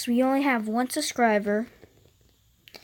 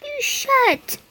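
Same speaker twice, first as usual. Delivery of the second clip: much higher yelled retort; 13 semitones higher, 5 dB louder than usual. Acted. State yelling the retort back at the other. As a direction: yell it right back.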